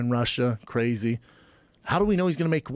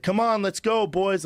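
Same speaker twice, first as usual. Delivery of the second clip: raised call to hurry; 6 semitones higher, slower than usual. Retold or imitated. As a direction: call the boys to move on. Showtime crew hurrying him away.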